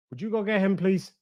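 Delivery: ordinary delivery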